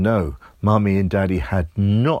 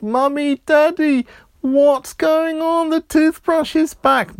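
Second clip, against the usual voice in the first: put-on high, louder panicked child call; frightened boy calling; 4 dB louder than usual. A narrator's reading. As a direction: cry out to his parents in fright. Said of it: "And he said".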